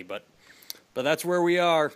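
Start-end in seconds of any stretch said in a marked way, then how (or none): none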